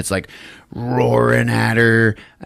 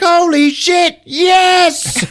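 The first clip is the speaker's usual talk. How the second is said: much higher, louder squealed yes; shrill exclaim; 6 dB louder, much slower than usual. Retold or imitated. Eve recognising the mosaic, hammed-up much higher squeal. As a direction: squeal it in shocked delight. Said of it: "And she's like".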